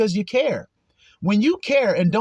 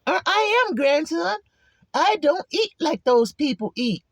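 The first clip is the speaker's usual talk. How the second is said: proud elder; 10 semitones higher than usual. Acted. Voicing a set-apart elder.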